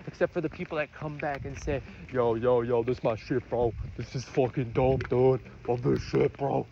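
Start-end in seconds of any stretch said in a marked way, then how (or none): 2.1-6.7 low-pitched put-on street accent. Vlogger imitating fans who come back to the music, put-on accent. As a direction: play a hyped fan in a low accent. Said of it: "That was not a bad accent".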